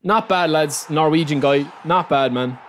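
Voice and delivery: usual speaking voice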